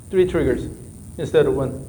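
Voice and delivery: normal talking voice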